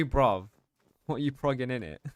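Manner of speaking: normal talking voice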